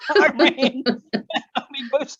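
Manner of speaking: high laughing voice, words bubbling through; laughing while telling. Real, not acted. Telling the interviewer how he fled, laughing. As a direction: laugh through the memory of running.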